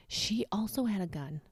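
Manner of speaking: hushed secret whisper. Real, not acted. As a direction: lean in and whisper it. Gossiping about neighbor.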